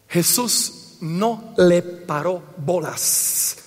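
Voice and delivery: very slow and low, word by word; slow, deliberate delivery. Real, not acted. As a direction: spell out "no le paró bolas" with weighty gaps. Slow announced punchline, then repeated. (muy lento, palabra por palabra)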